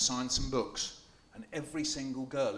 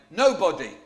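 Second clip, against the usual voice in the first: loud cheeky nobody, higher; Odysseus tricking the Cyclops with a false name; bold reply; 5 semitones higher, 13 dB louder than usual. Acted. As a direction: throw the word back proudly.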